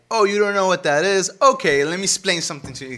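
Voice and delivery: loud, high mock condescension; hammed-up, patronizing outburst; condescending jerk. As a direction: exaggerate a know-it-all talking down. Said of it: "then you're just a dick".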